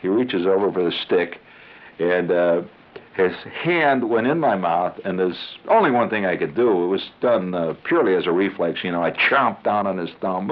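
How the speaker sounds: normal talking voice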